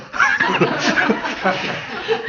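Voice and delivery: real laugh bursting out; amused cackle; high burst of laughter. Real, not acted. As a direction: laugh high and hard at yourself. Laughs at own demo.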